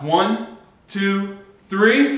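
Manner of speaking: shouted countdown, rising to three; loud count-in. Real, not acted. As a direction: call the count so the room hears. Counting in a group chant.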